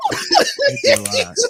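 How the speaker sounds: high, laughing cry of self-mockery; laughing shout. Real, not acted. Laughing at himself.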